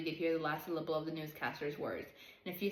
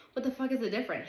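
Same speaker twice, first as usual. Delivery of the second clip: higher, louder furious outburst; angry shout; 6 semitones higher, 4 dB louder than usual. A narrator's reading. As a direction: lash out at the bickering men. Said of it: "shouted Guitar".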